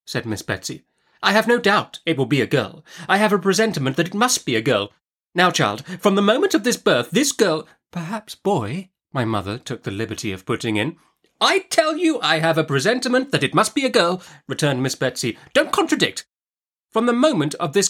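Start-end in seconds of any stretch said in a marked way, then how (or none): 1.2-7.7 high-pitched, certain aunt; insistent Miss Betsy. A narrator's reading. Aunt predicts the baby will be a girl, insistent. 7.9-8.9 higher, tentative little suggestion; timid interjection. A narrator's reading. Mother dares to suggest a boy, timid. 11.4-14.2 high, emphatic insistence; overruling Miss Betsy. A narrator's reading. Aunt rejects the suggestion of a boy, emphatic.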